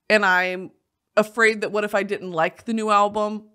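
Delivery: ordinary talk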